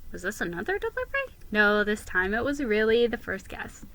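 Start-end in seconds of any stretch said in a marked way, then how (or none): none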